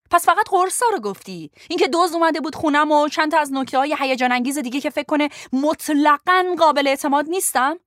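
high, loud, rising fury, rushing at the end; angry accusation. Acted. Lo furiously accusing Ben of spilling her secrets, high and loud. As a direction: hurl the betrayal at him.

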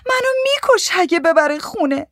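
very high, desperate cry; pleading shriek. A narrator's reading. Frightened wife begs a woman to save her, shrieking. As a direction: shriek it, begging for protection. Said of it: "جیغ زد".

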